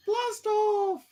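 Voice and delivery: squeaky high rocket-launch cheer; playful blast off. Pretend. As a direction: shout it like a kid launching a rocket. Playful rocket countdown finish.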